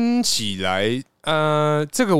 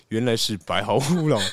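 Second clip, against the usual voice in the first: ordinary talk, nothing marked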